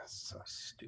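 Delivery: breathed under the breath; whispered aside. Real, not acted. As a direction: drop to a whisper for the jab. Muttered aside mid-sentence.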